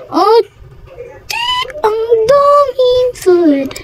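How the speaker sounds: high, drawn-out squeal of amazement; amazed squealing. Real, not acted. Player discovering lots of new food items, amazed. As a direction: squeal it high and stretch it slowly.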